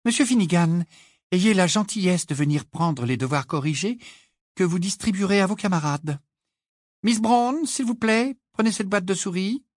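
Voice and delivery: high-pitched classroom instructions; high, commanding teacher's voice; brisk teacher. A narrator's reading. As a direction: hand out orders like a strict schoolmistress. Teacher gives orders to pupils.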